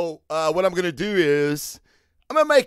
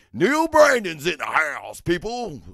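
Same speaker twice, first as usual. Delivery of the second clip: louder, announcer-style shout-out; hype announcer call; 3 semitones higher, 5 dB louder than usual. Real, not acted. Host greets a viewer, mock announcer. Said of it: "I don't know why I said it like that".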